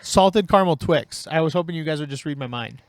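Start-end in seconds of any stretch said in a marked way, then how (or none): 0.0-1.3 mock-bratty kid asking for a treat; whiny kid voice. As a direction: whine it like a kid begging for candy. Joking about wanting candy.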